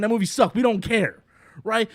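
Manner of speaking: normal talking voice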